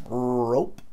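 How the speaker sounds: "Rope" starting on a long held R; exaggerated initial R in "Rope"; super thick R. Shown as a demonstration. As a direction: stretch the first sound of "Rope" into a heavy English R. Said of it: "Super grosso".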